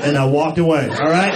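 ordinary delivery

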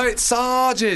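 Sajid as SAH-jid, high and drawn out; corrective "ah" pronunciation. Shown as a demonstration. Host overrules co-host's weird ears, Sajid as SAH-jid. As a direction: insist on a long "ah" in Sajid. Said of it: "you've got weird ears".

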